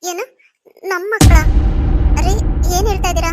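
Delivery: loud, startled exclamation; wife loudly shocked by talk of their children; stunned surprise. Acted. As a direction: blurt it out in shock.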